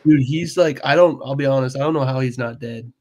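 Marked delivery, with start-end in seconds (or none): none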